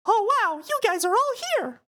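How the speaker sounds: delighted falsetto mouse voice; Mickey. Acted. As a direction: squeak it out, high and loud. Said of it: "so Mickey says".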